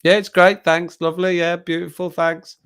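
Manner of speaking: high-pitched mock politeness; fake nice. Diner thanking staff for crap food, falsely nice. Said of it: "I'd be being nice about it".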